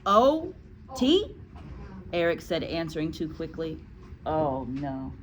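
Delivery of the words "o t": put-on high, questioning child voice; schoolboy spelling. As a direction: spell it out as an unsure little pupil. Eric spells as boy.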